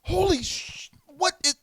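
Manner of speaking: high, loud disbelief; shocked outburst. Retold or imitated. Wrestler baffled at his own untied laces, loud and high. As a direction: blurt it out in high alarm.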